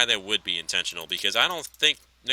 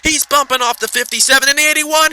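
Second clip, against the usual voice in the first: much higher and louder, building to a near-shout; excited race call; 12 semitones higher, 8 dB louder than usual. Real, not acted. Caller watching trucks go four wide, shouting excitedly. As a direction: yell it as they go four wide. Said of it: "My voice was going so high".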